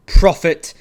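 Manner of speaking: loud ringing cry; loud accusation. A narrator's reading. Narrator accusing the raven, a loud cry. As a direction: fling the word at the bird. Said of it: "said I".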